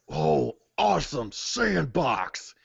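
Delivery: slow, drawn-out awe; swooping, drawn-out childlike delight; excited character. Acted. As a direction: marvel at it with stretched-out words. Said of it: "he's like".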